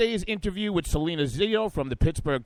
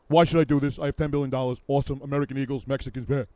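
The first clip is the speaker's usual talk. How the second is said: Donald Trump; 2 semitones lower, 4 dB louder than usual. Acted. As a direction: brag in short blurts as a mock tycoon. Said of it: "I'm picturing Rance Priebus in the Donald".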